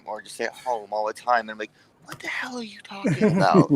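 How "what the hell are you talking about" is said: breathy high whisper; mock whispered confusion. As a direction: hiss it out as a baffled kid. His own retold reaction.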